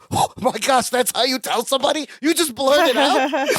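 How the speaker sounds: high, incredulous mock outrage; indignant flip. Imitating Larry scolding Balky for blurting it, indignant. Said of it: "an amazing Larry flip".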